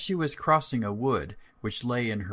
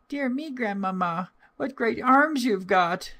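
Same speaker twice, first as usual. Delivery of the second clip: put-on much higher, louder little girl voice; 12 semitones higher, 4 dB louder than usual. Girl at grandmother's door, high child voice. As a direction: answer brightly like a small child. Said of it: "so she said to her".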